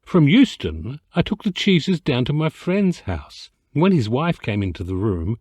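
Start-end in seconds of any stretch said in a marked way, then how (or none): none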